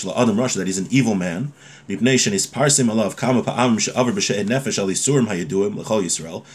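ordinary talk, nothing marked

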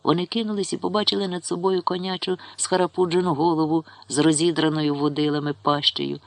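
ordinary delivery

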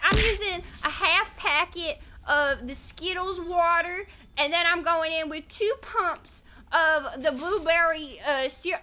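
slow Southern US recipe voice; imitated southern accent. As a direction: imitate a Southern lady slowly sharing her recipe. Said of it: "they always have a southern accent".

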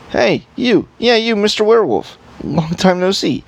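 cheerful elf voice. Acted. Playing a lively elf character.

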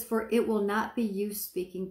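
normal talking voice

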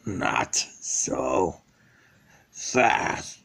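very deep, soft, halting voice; menacing villain. Acted. Villain halts the escaping bear, deep and menacing. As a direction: drop far down and stop them ominously.

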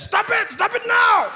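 very high, loud furious yell; angry dad yelling. Retold or imitated. Dad yelling at son to stop, very high furious shout. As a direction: shriek him into stopping. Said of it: "my dad would get upset".

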